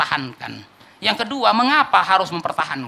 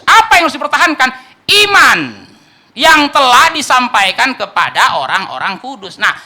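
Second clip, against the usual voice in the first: loud, higher, urgent sermon voice; preaching at full force; 9 semitones higher, 13 dB louder, slower than usual. Real, not acted. Preacher pressing the church on warning versus comfort, loud and urgent. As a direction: rouse the congregation, push every line.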